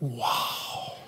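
breathless, awed whisper of wow. Retold or imitated. Preacher voicing Adam first seeing his wife, whispered wow. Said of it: "his woman, he went".

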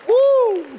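high excited woo; joyful whoop. Real, not acted. Preacher celebrating the name called over him, high whoop. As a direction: let out a high shout of joy.

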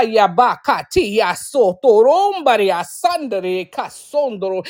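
fervent glossolalia burst; speaking in tongues. Real, not acted. Preacher breaks into tongues before the podcast congregation. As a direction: pour out tongues in the spirit.

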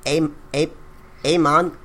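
halting attempt at a Spanish accent; hesitant, badly faked Spaniard. Shown as a demonstration. Podcast guest trying on request, shaky Spanish accent. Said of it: "I'll do a Spaniard".

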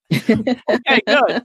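high, laughing relief; relieved laughing okay. Retold or imitated. Student laughing with relief at his professor's answer, high and giggly. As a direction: laugh it off, pitched well up.

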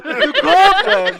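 high, loud, slow wail through laughter; laughing. Real, not acted. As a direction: stretch it out cracking up. Teasing Lisa about cobwebs.

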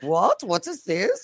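mock puzzled questioning; imitated baffled shopkeepers. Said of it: "they were like".

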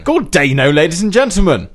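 loud echo of the intro; announcer voice. Acted. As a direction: boom it out like a showman. Echoing the host's announcement.

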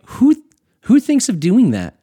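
high, loud, disbelieving cry; playfully voicing a listener's shocked disbelief; incredulous outburst. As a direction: react like it's the craziest idea ever. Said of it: "You're like".

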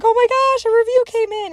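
very high, loud excited squeal; squealing excitement. Retold or imitated. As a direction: squeal it with delight. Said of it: "I'm like".

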